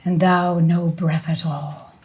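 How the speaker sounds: slow, grave Shakespeare reading; dramatic recitation. Acted. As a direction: recite it like a tragic actor. Recites King Lear.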